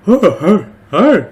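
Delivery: high, loud, laughing dog voice. Acted. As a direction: play a goofy excited dog. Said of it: "the dog voice".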